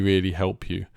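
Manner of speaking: ordinary talk, nothing marked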